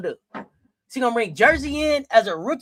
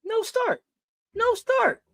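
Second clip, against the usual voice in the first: incredulous; 11 semitones higher than usual. Real, not acted. Reading chat votes, incredulous.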